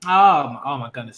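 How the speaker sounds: ordinary talk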